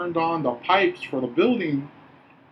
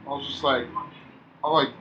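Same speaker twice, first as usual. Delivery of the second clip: ordinary talk, nothing marked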